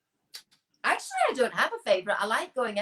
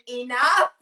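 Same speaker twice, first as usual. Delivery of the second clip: Sammy; 3 semitones higher, 8 dB louder than usual. Retold or imitated. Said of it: "Sammy saying".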